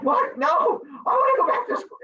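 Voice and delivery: high, protesting outcry; hammed-up panicked backpedal; mock dismay. Teacher mimics student when pandemic closes school, dismayed. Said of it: "And you're like".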